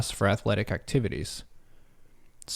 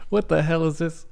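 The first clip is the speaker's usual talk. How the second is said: baffled; 6 semitones higher than usual. Real, not acted. Reacting to a strange answer option.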